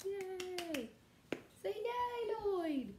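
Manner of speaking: high, bright cheering to coax a pet; excited cheer. Real, not acted. Teacher urging her dog to cheer for the kids, high and excited. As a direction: get the dog to join in the cheer.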